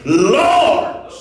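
loud, high call to the Lord; crying out. Acted. Preacher voicing a seeker asking the Lord, crying out. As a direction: cry it out from the pulpit. Acts out a desperate prayer.

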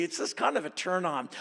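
ordinary talk, nothing marked

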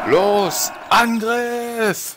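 rally the troops with a loud cry; mock commander yelling attack; battle cry. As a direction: shout the charge order.

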